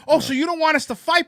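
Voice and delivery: loud, high mocking comeback; loud sneering sarcasm; sarcastic retort. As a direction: needle the guy with sarcasm. Sarcastic retort to gun tweet.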